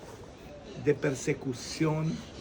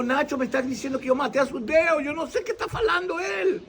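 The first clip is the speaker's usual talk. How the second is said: put-on child complaining; 10 semitones higher than usual. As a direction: whine it like an upset little boy. (niño quejándose a su papá con voz aguda y fuerte)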